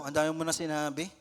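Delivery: ordinary talk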